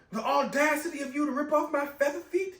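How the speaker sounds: lightly hammed-up wounded pride; mock outraged Namor. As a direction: act deeply offended. Namor quoted in pain.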